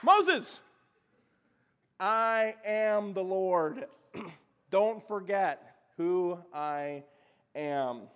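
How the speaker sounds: loud call then slow solemn I am the Lord; God speaking slowly and firmly. Acted. God firmly reminding Moses who he is, slow and solemn. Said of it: "And he".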